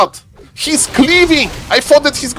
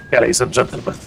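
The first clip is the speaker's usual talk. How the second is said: much lower put-on voice; deep put-on voice; 11 semitones lower, 7 dB quieter, much faster than usual. A deep put-on voice for the tier three sub. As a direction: sink into the special deep voice. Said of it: "talk like this".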